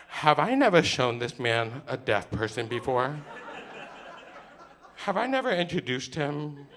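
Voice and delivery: loud, incredulous comic rant; exasperated inner rant. Acted. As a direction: cry it out in disbelief at your friend. Exasperated thoughts about friend's bad signing.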